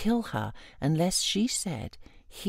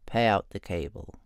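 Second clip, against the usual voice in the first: a deep, lower voice for Nos; Nos, low and steady; 10 semitones lower than usual. Acted. Nos tells the troll to pay out the cable, low. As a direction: say it low and level, in command.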